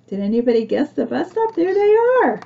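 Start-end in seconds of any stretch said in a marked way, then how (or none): 1.5-2.4 much higher, louder pointing out; bright cry of discovery, pitched way up; spotting friends in the picture, much higher and louder; excited spotting. Pretend.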